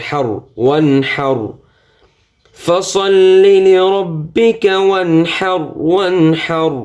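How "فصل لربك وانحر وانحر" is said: high, very slow melodic tilawat; measured Quranic chant; Quran recitation. Shown as a demonstration. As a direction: stretch every vowel in a held chant. Recites the verses.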